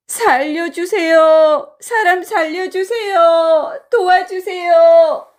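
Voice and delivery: high, long-drawn cries for help; wailing plea, each phrase stretched out; crying out for help. Acted. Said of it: "소리를 질렀어요".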